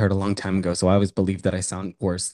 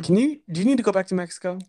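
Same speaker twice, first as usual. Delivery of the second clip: teasing high squeal; 9 semitones higher, faster than usual. Acted. Teasing high fast jab.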